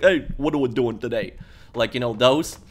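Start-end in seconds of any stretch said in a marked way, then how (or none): 0.0-1.3 New York street-guy greeting, lightly imitated; deeper New York accent. Shown as a demonstration. Gamer imitating the game's gangsters, deeper New York accent. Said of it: "a little bit of the more deeper accent".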